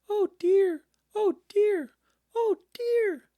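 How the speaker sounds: very high, loud squeaky piglet voice; fretful little piglet squeal of dismay; frightened Piglet. A narrator's reading. Piglet frets to himself near the trap, very high, fearful squeak. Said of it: "said Piglet to himself".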